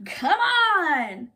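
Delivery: loud, drawn-out beckoning call. A narrator's reading. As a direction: call a friend to come along. Bear calls out to friend.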